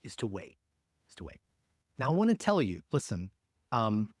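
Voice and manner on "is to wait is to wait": trailing to silence. Shown as a demonstration. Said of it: "this is the hardest one".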